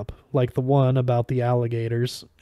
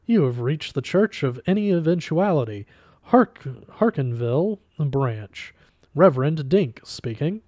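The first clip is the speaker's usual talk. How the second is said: usual speaking voice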